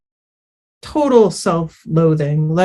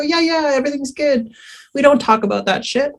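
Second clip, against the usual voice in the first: dismissive brush-off; 7 semitones higher, faster than usual. Retold or imitated. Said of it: "you go".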